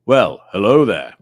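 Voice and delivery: low, loud put-on hello; a deep and booming well hello there; Ivor Howard's voice. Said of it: "that's how Ivor, Ivor Howard speaks".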